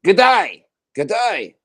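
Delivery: high, loud mock Aussie greeting; exaggerated Australian accent. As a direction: belt out an Australian "g'day". Joins in mimicking an Australian.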